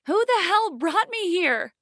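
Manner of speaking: high, loud panicked demand; frightened outburst. A narrator's reading. Kidnapped girl waking in a dusty room, loud high cry. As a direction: shout it at the locked room.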